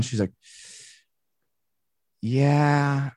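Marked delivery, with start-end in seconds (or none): 2.2-3.1 long, stretched-out "yeah"; slow, lingering reply; drawn out. Retold or imitated. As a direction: draw the word out, stalling. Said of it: "She's really drawing it out".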